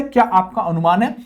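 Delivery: ordinary talk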